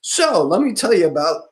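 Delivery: high, loud mock sales opener; pushy salesman pitch. Stranger on the first day launching a pushy pitch. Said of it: "coming in on the first day is like".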